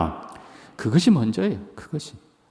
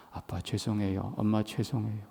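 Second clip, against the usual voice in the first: softer, hushed sorry; contrite apology; 6 semitones lower, 13 dB quieter than usual. Retold or imitated. Grown child apologising to mom and dad, hushed and contrite. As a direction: say sorry quietly like a chastened child.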